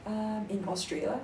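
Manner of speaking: usual speaking voice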